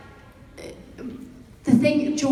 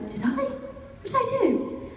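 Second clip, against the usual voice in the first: retold puzzlement; 12 semitones higher, 4 dB quieter than usual. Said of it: "And I was like".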